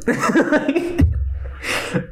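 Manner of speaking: high burst of laughter. Real, not acted. Cracking up after a Lando impression.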